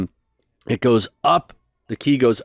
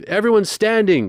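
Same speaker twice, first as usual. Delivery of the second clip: high, called out to the whole crowd; rally the room to stand; mock choir director; 11 semitones higher than usual. Choir director calling the audience to its feet. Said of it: "That's what he would do".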